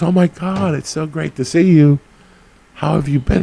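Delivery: high, excited gushing greeting; mock overjoyed woman. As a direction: gush like she's thrilled to see him. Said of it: "she goes".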